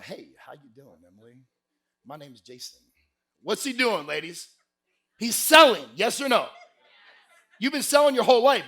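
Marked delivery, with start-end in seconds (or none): 0.0-2.8 low, very soft come-on; imitation of a suave flirt; smooth pickup voice. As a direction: hush it right down like a guy at a bar. Jason chatting up Emily at bar.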